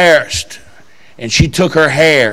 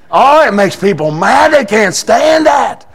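preaching shout; 9 semitones higher, 4 dB louder than usual. Real, not acted. Preacher shouting to his church. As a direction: shout it out to the congregation.

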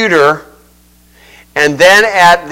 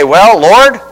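Appalachian accent; 2 semitones higher, 4 dB louder than usual. Shown as a demonstration. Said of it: "you get your hillbilly twang or my Huck Finn twang".

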